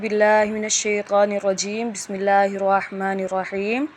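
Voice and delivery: slow, measured Arabic recitation; Quran recitation. Real, not acted. Teacher opens a Qaida lesson, slow recitation. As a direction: recite the opening invocation slowly, drawn out.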